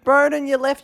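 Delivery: hammed-up panic for the missing guest; mock panic. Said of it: "having a panic attack going".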